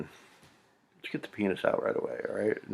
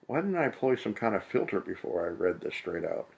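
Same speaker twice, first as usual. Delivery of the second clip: ordinary talk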